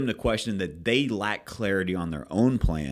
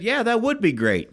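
much higher, louder client voice; mimicking the client; 13 semitones higher, 4 dB louder than usual. Manager retelling client's reply, high mock client voice. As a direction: play the client happily agreeing. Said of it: "he said".

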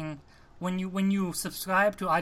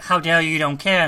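louder, indignant retold quote; retold outburst; 8 dB louder than usual. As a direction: throw it back at him loudly, half acted. She defies him, retold.

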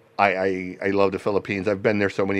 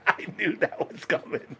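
laughing; 14 semitones higher than usual. Real, not acted. Laughing at friend's answer.

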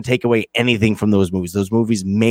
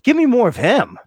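eager; 8 semitones higher than usual. Retold or imitated. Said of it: "I'm like".

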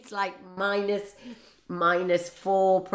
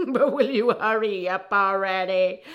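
put-on American accent laughing; 7 dB louder than usual. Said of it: "in an American voice".

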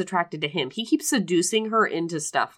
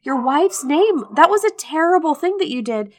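higher, louder mock indignation; indignant scolding; 6 semitones higher, 3 dB louder than usual. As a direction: tell him off, hurt and outraged. Heroine scolding in retold scene.

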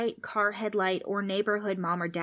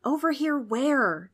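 slow, higher-pitched confused question; puzzled asking; 6 semitones higher, 4 dB louder, slower than usual. A narrator's reading. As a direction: ask it slowly, totally lost. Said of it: "Trix asked".

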